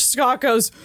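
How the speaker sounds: ordinary delivery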